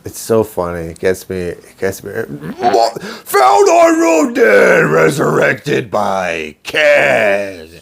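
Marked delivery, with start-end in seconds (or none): 2.1-7.7 shouted, hugely drawn-out announcer imitation; over-the-top monster truck commercial voice; monster truck promo. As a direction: bellow it like an arena promo, high and strained. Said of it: "Monster Jam style promo".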